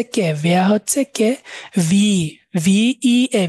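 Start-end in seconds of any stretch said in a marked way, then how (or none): 1.9-3.5 slowly name each letter ভি ই এ with pauses; spelled letters slowly; spelling out. Shown as a demonstration. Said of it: "ডব্লিউটাকে আমরা ভি উচ্চারণ করব".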